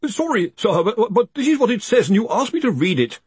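high, stammering, nervous underling; apologetic and flustered, voice pitched right up; flustered protest. A narrator's reading. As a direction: protest anxiously to an angry boss. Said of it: "Collarbone protested".

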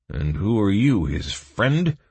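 probing question, rising in pitch; suspicious. A narrator's reading. Guard questioning the stranger, suspicious. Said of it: "squinted the guard suspiciously".

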